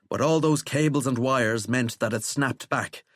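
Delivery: plain reading voice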